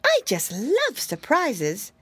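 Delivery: put-on high, fretful little girl voice; a tiny frightened mouse wailing; timid mousy girl. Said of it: "said the mousy girl".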